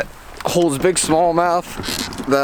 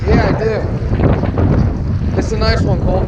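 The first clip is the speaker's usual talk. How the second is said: excited; 5 semitones higher than usual. Real, not acted. Fish on the line, then lost.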